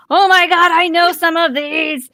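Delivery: high, loud mock chat yell; thrilled fan yelling along at a show; excited yelling. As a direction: re-enact typing in all caps.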